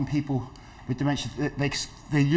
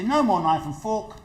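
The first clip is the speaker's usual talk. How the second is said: slow, higher, soothing; gentle reassurance; 6 semitones higher than usual. Shown as a demonstration. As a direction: reassure them kindly. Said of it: "You say".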